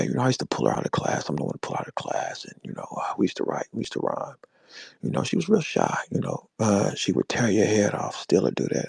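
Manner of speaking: mock his dropped phone voice, full of hesitations; murmured smooth-talker imitation.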